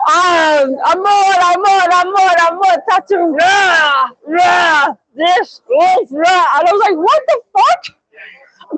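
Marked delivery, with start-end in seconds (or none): 0.9-6.5 high babble with drawn-out cries; imitated old lady. Old Spanish lady going on about a tattoo, high excited imitation. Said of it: "she was going like this". 7.0-7.8 very high-pitched incredulous question; baffled disbelief. Retold or imitated. Streamer baffled by the old lady, mock high disbelief.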